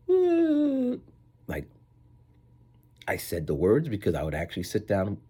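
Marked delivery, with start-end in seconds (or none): 0.1-1.0 very high, loud drawn-out ooh; mock cooing ooh. Denying he was just making noises, high mock ooh. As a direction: falsetto coo. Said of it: "I wasn't saying".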